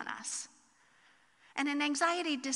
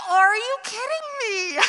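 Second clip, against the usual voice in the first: much higher, louder cry of disbelief; exasperated disbelief; 9 semitones higher, 12 dB louder than usual. Retold or imitated. Mom seeing the hat spoiled surprise, exasperated wail. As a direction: wail it in mock despair. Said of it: "And I was like".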